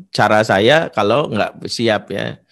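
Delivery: usual speaking voice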